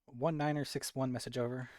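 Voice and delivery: loud clipped radio call; police radio. Acted. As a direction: report in like on comms. Radio report to command.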